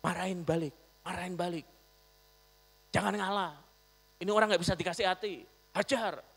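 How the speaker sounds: a touch of heated urging, quick at the end; mild angry inner voice. Retold or imitated. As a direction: egg yourself on to hit back. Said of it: "Suara Om jujur". (suara hati yang agak marah)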